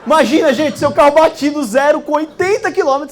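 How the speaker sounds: loud giddy exclamation with a laugh; excited outburst. Real, not acted. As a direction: burst out in laughing disbelief. Excited retelling of wrecked car.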